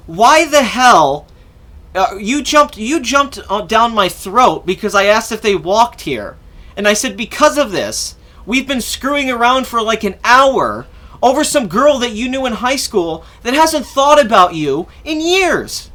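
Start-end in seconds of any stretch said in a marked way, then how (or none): 0.1-6.4 indignant retelling, slow and emphatic; retold pissed-off outburst. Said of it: "I was pretty pissed". 7.2-15.9 drawn-out, higher-pitched angry complaint; indignant retelling, slow and emphatic; retold pissed-off outburst. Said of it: "I was pretty pissed".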